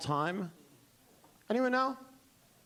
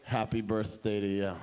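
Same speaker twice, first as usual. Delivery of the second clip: low, muted delivery; deep, underplayed presentation; understated repeat pitch; 10 semitones lower than usual. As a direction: drop it low, as a knowing reveal. Same line pitched as second line.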